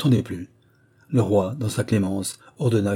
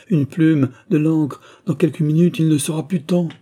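urgent plea; 6 semitones higher, 5 dB louder than usual. Acted. Father begging the king's men, urgent and raised. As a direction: pitch rising high and loud with urgency. Count begs for the pardon.

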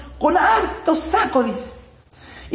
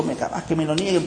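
nervously, much softer; a worried murmur, much softer and lower; nervous, quiet; 7 semitones lower, 6 dB quieter than usual. Retold or imitated. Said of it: "Y él muy nervioso dijo".

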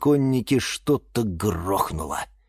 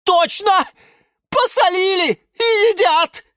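very high, loud alarm; horrified outcry; 5 dB louder than usual. A narrator's reading. Plant watching the cactus get salted, horrified. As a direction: squeal it in horror.

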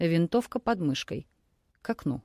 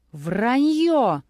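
loud, higher yell of denial; screaming; 5 semitones higher, 6 dB louder than usual. A narrator's reading. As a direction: scream it as a lie. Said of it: "завопил маньяк".

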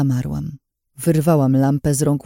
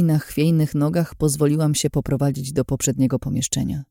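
plain reading voice

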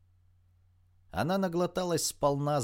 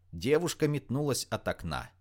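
usual speaking voice

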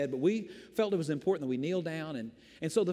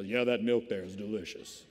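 deep announcer voice about milk; radio voice; 7 semitones lower than usual. Retold or imitated. Pastor mimicking friend's radio voice at store. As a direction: say it like a radio host in a grocery aisle. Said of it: "he talked to you like that".

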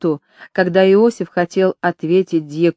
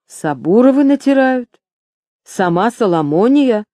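high, rising astonished questions; incredulous mishearing; 5 semitones higher, 3 dB louder than usual. A narrator's reading. Metropolitan mishears the remedy's name, astonished. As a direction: ask it in disbelief. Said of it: "неправильно расслышал митрополит".

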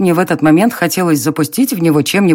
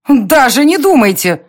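angry shout; 8 semitones higher, 4 dB louder than usual. A narrator's reading. Heroine furiously refusing.

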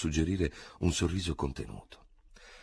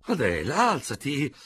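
urging again; 7 semitones higher, 3 dB louder than usual. A narrator's reading. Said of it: "Léonie urges the girl again".

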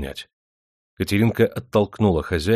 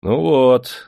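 high, loud relieved exclamation; relieved, lifted tone; 6 semitones higher, 6 dB louder than usual. A narrator's reading. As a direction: let relief out in the voice. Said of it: "облегчённо вздохнул Демидов".

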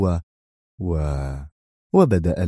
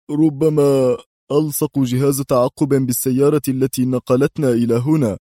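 nervous, slightly raised voice; very tense; 4 semitones higher, 4 dB louder than usual. A narrator's reading. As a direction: voice a tense suspicion. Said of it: "في توتر شديد وهو يقول".

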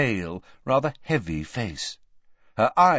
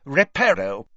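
firm, raised-pitch incantation; 7 semitones higher, 4 dB louder than usual. A narrator's reading. Official casting a mending spell, firmly. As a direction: cast it decisively. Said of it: "and said firmly".

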